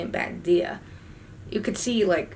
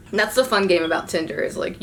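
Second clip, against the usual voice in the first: normal talking voice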